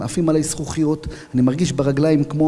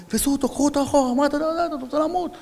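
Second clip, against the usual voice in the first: alarmed shouting; 14 semitones higher than usual. Retold or imitated. Comrades restrain him, shouting.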